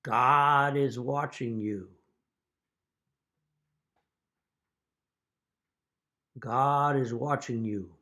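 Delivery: slow, lightly voiced ghostly warning; eerie parrot voice. Telling the joke, voicing the unseen parrot slowly and eerily. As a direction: drag it out like a hidden voice spooking a burglar.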